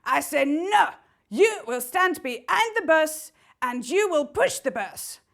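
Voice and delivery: mild mock French saboteur; lightly French-accented bossy villain. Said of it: "French accent".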